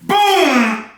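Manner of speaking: high, sharp crash noise: boom; mock crash boom. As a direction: shout out a car crash boom. Car crash sound retold.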